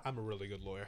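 low, quiet put-on voice; movie quote voice. Quoting the film's lawyer line to friends, low and soft. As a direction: murmur the line like the actor.

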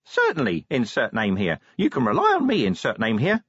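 imp voice. Acted. Imp replies.

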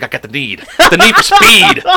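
laughing, jumps high on speed; hammed-up Tom Cruise. Friend pitching a Top Gun parody, hammed-up and laughing. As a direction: belt out speed with a cracking laugh. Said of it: "He's like".

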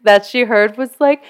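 ordinary talk, nothing marked

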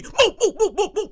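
acted bustling security; security guard. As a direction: clear the crowd urgently. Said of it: "And then you hear security".

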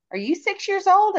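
high-pitched retold question to a kid; mock kid-friendly high voice; talking to a child. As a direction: ask a little one, voice lifted way up. Asking a small child, retold.

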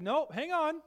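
calm them down gently but lifted; reassuring Jesus. Acted. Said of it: "he said".

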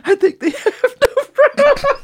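high squealing laughter swallowing the words; reading aloud and bursting into laughter; cracking up. Real, not acted. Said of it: "So this is genuine".

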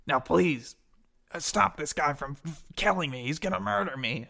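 pleading goblin. Acted. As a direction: beg for your life as a goblin. Beaten goblin begs for help.

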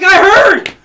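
high, loud fed-up cry; exasperated shout. Retold or imitated. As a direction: yell it out at the end of your rope. Exasperated punchline at the counter.

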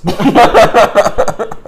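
high, loud burst of laughter; loud cackle. Real, not acted. Friend cracks up at the joke, loud cackle. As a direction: crack up at your own joke.

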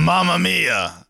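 slow, drawn-out mock Italian "Mamma mia"; Italian accent, hammed up and slow. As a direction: stretch it out like a cartoon Italian. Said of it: "don't know if that was an Italian accent".

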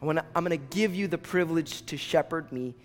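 low, soft, earnest prayer. Real, not acted. Preacher leading people back to God, praying softly. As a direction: pray it quietly, with pauses between phrases.

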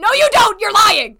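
very loud, high mock outrage; playful shout. Real, not acted. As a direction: yell the accusation, laughing.

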